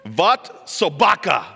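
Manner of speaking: put-on loud, slow, harsh Russian accent. Speaker quoting Lenin's last words, harsh Russian. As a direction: punch it out in stern Russian. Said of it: "I'm gonna do the Russian version of it".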